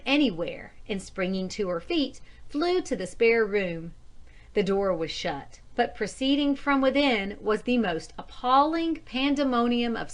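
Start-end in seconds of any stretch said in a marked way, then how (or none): none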